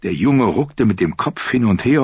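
usual speaking voice

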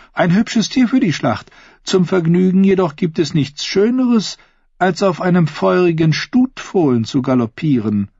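high-pitched, suggestive royal lady; flirting queen. Acted. Queen flirting with Sir Osney about his horse, high teasing voice.

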